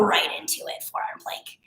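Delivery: soft, breathy whisper into the plank; hushed cue. Real, not acted. Instructor whispering cues mid-plank. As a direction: whisper the cue under strain.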